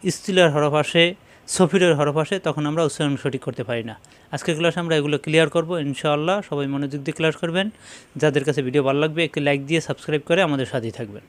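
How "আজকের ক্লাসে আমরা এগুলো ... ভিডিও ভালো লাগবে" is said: ordinary talk, nothing marked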